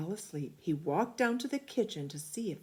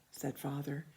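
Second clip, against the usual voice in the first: plain reading voice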